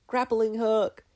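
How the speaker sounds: put-on high, loud girl's cry; triumphant shout. Mabel firing her hook, loud and triumphant. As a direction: yell it out in triumph.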